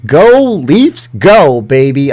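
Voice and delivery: loud, high-pitched fan cheer; team cheer. Acted.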